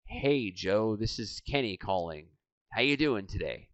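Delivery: soft, low phone greeting; underplay the greeting, quiet and low; low-energy caller. Acted. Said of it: "Out of rapport".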